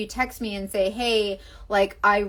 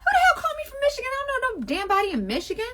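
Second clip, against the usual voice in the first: mock indignant auntie; 14 semitones higher, faster than usual. Mock outraged Southern caller.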